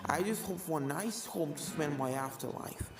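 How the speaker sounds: a creepy calm killer ghost toying with her prey; ghost Jack. Acted. Ghost killer explains herself to the filly, softly menacing.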